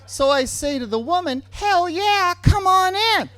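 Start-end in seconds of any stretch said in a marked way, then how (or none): 1.5-3.3 loud, high whoop of invitation; excited welcome. Retold or imitated. Boyfriend welcomes the woman into the motel room, excited shout. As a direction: shout it eagerly, pitched way up.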